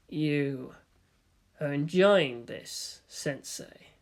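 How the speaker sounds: low, slow, hushed accusation; fading to a breath at the end; Gendo, cold. A narrator's reading. Gendo to his old teacher, low and slow.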